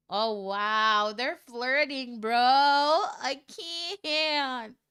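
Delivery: loud, giddy reaction to the flirting; excited outburst. Real, not acted. Reader reacts loudly to the flirting. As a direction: yell out like you can't take it.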